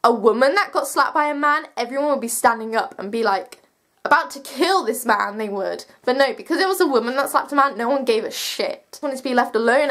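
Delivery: ordinary talk, nothing marked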